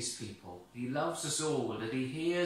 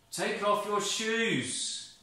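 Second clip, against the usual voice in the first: high, slow, solemn command from the burning bush; voice of God; 8 semitones higher, 4 dB louder, slower than usual. Acted. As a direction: call out grandly from the bush.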